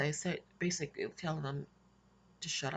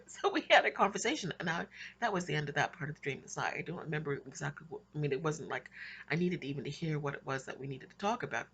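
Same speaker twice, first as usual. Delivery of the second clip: ordinary delivery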